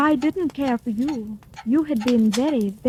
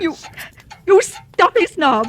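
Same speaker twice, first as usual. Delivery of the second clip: louder, slow, spat-out insult; angry outburst; 4 semitones higher, 4 dB louder, slower than usual. Acted. Rejected widow to the lord, angry outburst. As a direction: hurl the insult at him.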